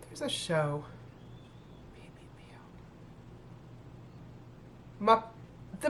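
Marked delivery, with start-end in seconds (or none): 1.9-2.6 whispered little bear voice, R softened in "bear"; barely audible try at a puppet cub's "bear"; Baby Bear impression. Vlogger recalling which show says it that way, whispered Baby Bear impression. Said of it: "That's how he talks".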